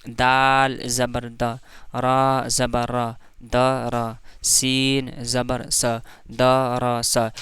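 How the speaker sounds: each letter read out slowly; slow recitation demo. Shown as a demonstration. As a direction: recite slowly so the class can follow. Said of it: "तो देखिए जैसे".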